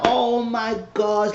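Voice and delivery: high, slow, drawn-out gasp of words; scandalized shock. Retold or imitated. Onlooker shocked at Eddie's offer, scandalized. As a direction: stretch it out, high and aghast.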